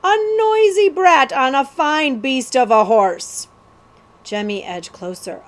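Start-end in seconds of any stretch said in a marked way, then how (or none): 0.0-3.5 loud, raised ruffian voice; rough booming. A narrator's reading. Cutthroat sizes up prince and horse, rough booming. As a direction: boom it out as a rough outlaw. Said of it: "booming reply".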